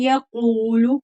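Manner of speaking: يقول with a full, heavy back-of-throat qaf and a long ū. Shown as a demonstration. Teacher showing the heavy qaf in يقول, slow careful demo. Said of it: "काफ पुर पढ़ा जाएगा".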